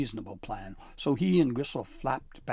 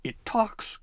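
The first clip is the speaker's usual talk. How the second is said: much higher surprised troll yelp; 11 semitones higher, 5 dB louder than usual. A narrator's reading. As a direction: squeal it out in shock. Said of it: "Gristle yelped".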